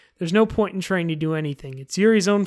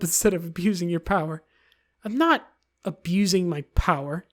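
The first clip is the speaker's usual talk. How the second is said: ordinary delivery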